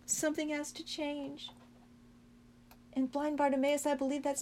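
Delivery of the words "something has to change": hushed repeat. Shown as a demonstration.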